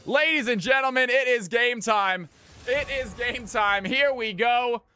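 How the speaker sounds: high, loud shouted announcer hype; hype match announcer. Acted. Streamer opens the game like an arena announcer, shouting. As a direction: pump up the crowd like a caster.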